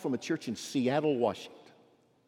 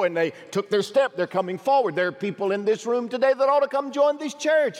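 raised, pleading preacher's invitation; altar call; 6 semitones higher, 5 dB louder than usual. Acted. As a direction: call people to walk forward.

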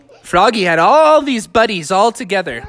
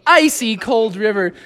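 usual speaking voice

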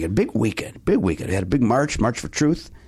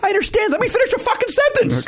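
mock exasperated.

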